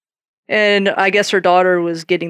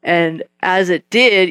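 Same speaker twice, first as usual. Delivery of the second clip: ordinary talk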